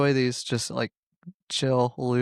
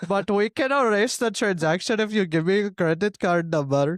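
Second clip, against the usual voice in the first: put-on higher Indian-accented call center voice; Indian telemarketer accent; 5 semitones higher than usual. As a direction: play an Indian phone scammer. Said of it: "Indian telemarketer".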